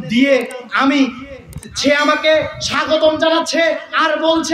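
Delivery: high, chant-like preacher's cry; fervent sermon storytelling at high pitch; impassioned preaching. Acted. Preaches the story at full force.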